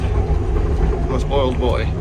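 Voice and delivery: on a bumpy road. Real, not acted. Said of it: "Sorry about the shaking".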